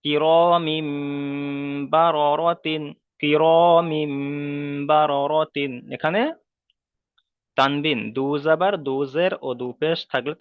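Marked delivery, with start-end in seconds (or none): 0.0-2.8 "কিরামিম" with a long hummed final m, then "বারারাতিন"; ghunnah recitation demo. Shown as a demonstration. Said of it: "তানবিনের ক্ষেত্রে দেখেন". 3.2-5.7 "কিরামিম" with a long hummed final m, then "বারারাতিন"; ghunnah recitation demo. Shown as a demonstration. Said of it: "তানবিনের ক্ষেত্রে দেখেন".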